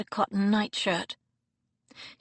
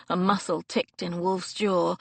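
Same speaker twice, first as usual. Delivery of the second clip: usual speaking voice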